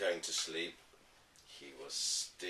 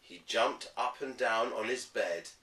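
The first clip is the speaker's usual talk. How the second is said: ordinary delivery, nothing marked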